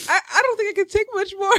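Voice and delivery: high, fast, tearful words; overwhelmed by the message, crying through it. Real, not acted. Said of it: "so totally making me cry".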